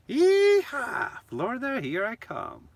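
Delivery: high, loud yeehaw whoop, easing off; cowboy whoop. Pretend. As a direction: whoop like you're heading off south. Cheering his planned trip to Florida.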